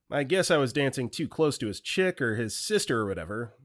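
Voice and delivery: plain reading voice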